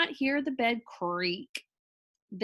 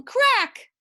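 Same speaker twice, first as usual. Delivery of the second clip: cracking sound effect; 12 semitones higher, 8 dB louder than usual. A narrator's reading.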